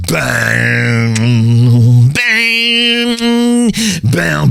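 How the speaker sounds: long, drawn-out sung-out bangs; imitated band crash. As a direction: boom out each crash and let it ring.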